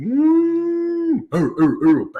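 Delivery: high hummed entrance theme; imitated entrance music. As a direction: hum a wrestler's entrance tune. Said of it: "That was pretty good".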